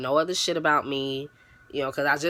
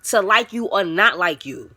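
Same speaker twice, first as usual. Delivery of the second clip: firm confrontation; 5 semitones higher, 6 dB louder than usual. Retold or imitated. Confronting the girl who said she had a problem. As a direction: lay it out point by point.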